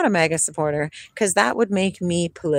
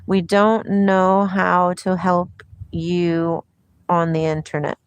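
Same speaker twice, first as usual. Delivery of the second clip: ordinary delivery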